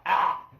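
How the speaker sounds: loud, high single "ah"; mock startled yell. You react to the dog's attack, mock yell. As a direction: yelp as if bitten. Imitates person being attacked by dog.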